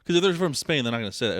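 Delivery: ordinary talk, nothing marked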